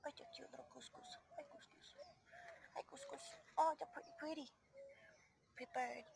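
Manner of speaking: high whispered greeting. Real, not acted. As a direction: whisper hello to the chick. Whispering to baby bird.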